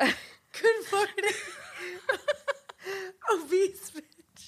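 high, slow, laughing through the quote; can barely get it out for laughing; laughing through words. Retold or imitated. Quoting insult while cracking up.

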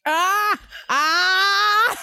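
loud, high cry of disgust; disgusted yell. Real, not acted. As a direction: yell out at the awful taste. Tasting the spoiled milk jelly bean.

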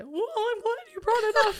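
high, loud mock eagerness; acting out own reply. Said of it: "And I was like".

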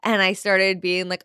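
usual speaking voice

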